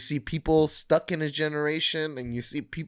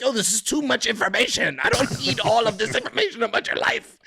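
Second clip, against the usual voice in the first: fed-up quote; 7 semitones higher, faster than usual. Retold or imitated. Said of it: "I'm just like".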